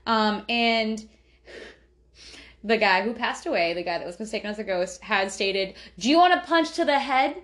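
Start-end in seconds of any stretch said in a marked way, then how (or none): 6.0-7.4 higher, louder mock threat; threatening. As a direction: sneer it as a challenge to fight. Acted threat from the deceased.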